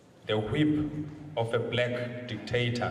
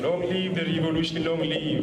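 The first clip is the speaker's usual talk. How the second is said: ranting dictator speech; 6 semitones higher, 3 dB louder than usual. Real, not acted. Leading crowd in revolutionary slogans.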